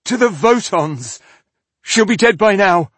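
high, loud panicky outburst; scared captive shouting a warning; frightened cry. A narrator's reading. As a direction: blurt it out in dread. Terrified captive blurts answer.

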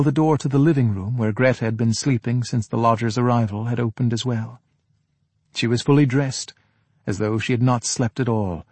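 ordinary delivery

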